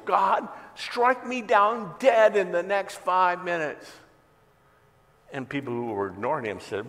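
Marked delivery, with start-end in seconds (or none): 0.0-3.9 slow, much higher and louder defiant yell to the sky; yelling at God. Retold or imitated. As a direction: bellow it upward, daring heaven. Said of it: "And he yells".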